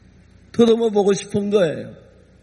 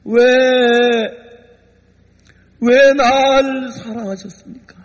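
fervent plea; 3 semitones higher, 3 dB louder, slower than usual. Retold or imitated. Pastor pleads to God.

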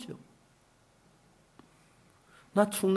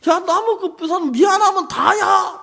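higher, irritated shout at a friend; retold angry shout; 13 semitones higher, 9 dB louder than usual. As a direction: snap at the friend who broke it. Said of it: "막 내가 짜증을 냈거든". (친구에게 짜증내며 소리치기)

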